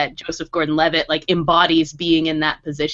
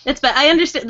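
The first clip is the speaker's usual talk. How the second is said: normal talking voice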